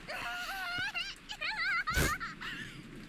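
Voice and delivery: piercing high cackle; a high, wild laugh; high shrill character laugh. Acted.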